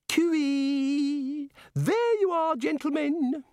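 very high singsong cooee; fluting, drawn-out greeting; trilling call. Acted. Missus Whitlow hailing the gentlemen, high and singsong. Mrs Whitlow calls out on arrival.

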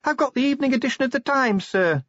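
high, tinny voice through a tube; far-off voice down a pipe; distant speaking tube. Acted. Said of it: "distant voice of Sergeant Littlebottom".

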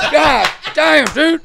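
high, loud yelp through laughter; loud mock outcry breaking into laughs; retold shock. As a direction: imitate a stunned friend, laughing.

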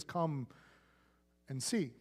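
Jesus inviting them into his life, tenderly; tender invitation. Retold or imitated. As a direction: let "and see" fall to a near hush. God's invitation to listeners.

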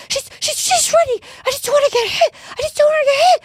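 very high panicked caller voice; frantic older lady. Retold or imitated. Imitating the lady afraid the dog gets hit, frantic and very high. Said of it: "This lady's, like, freaking out".

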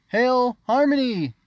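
high, loud, drawn-out hail; a slow public cry of homage; calling out. A narrator's reading. Unsure subjects to their queen, drawn-out hail. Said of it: "Unsure voices called out".

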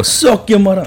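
high-pitched put-on Jamaican accent; mock Jamaican accent. Showing friends Jamaican cursing, mock accent. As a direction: swear in a hammed-up Jamaican accent. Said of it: "In Jamaica".

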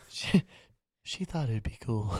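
soft, low-key put-on voice. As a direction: say it very softly, as a joke. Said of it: "So you're [name] now?".

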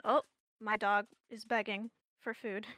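usual speaking voice

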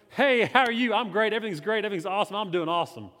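overly upbeat church small talk; put-on cheerful greeting. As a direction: act like everything is awesome. Acts out his Sunday morning cheerful face.

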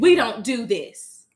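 loud motherly scold, fast at first; mock Mama Tina scolding. Mother Tina scolding her family, loud and firm. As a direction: lay down the law for the family. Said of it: "Mama Tina was like".

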